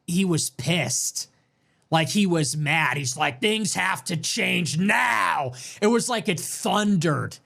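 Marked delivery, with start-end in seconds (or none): 3.4-5.5 very slow, drawn-out shouted roar; mock angry rally roar; Trump roar. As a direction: growl it out like a furious candidate. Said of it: "so guttural".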